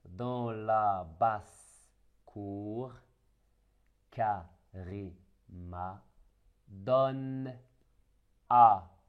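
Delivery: slow, each word set apart for pupils; word by word reading. Shown as a demonstration. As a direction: read it word by word to children. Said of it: "le petit paragraphe".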